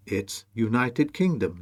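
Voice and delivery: usual speaking voice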